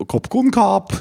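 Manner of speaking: high, eager Kopp kon kap!; boatman's eager reply. Retold or imitated. Boatman agreeing to rush him out, high eager call. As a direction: chirp it back like the Thai boatman. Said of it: "Og han bare".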